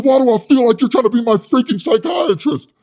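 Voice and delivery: high, loud, puffed-up yelling; loud hothead Wes. Retold or imitated. Wes scolding his soy boy cellmate, loud and high. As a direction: rant like a tough guy with something to prove.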